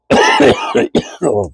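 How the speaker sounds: a hacking cough, congested chest; real chesty cough. Real, not acted. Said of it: "Excuse me".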